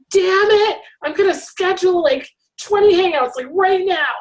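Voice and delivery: fed up, mock-announcing a scheduling spree; frustrated outburst.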